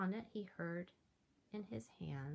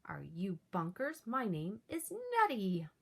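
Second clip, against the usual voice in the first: howling monster; 8 dB louder than usual. Acted. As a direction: roar the name at him. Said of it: "with a horrible howl".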